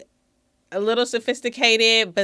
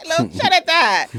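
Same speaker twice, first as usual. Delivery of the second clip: ordinary talk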